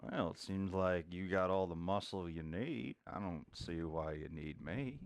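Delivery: lightly voiced old Western cowboy, low and drawling; gruff cowboy NPC. Old cowboy tells the noisy party to pipe down, lightly voiced. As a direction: warn the rowdy newcomers off, a cowboy low and steady.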